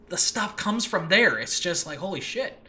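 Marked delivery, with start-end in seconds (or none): none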